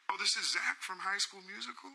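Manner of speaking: a hint of a gentle, high idol on the phone. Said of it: "And he goes".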